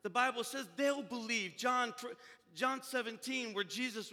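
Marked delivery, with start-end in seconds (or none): none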